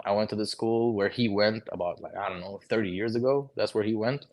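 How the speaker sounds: ordinary talk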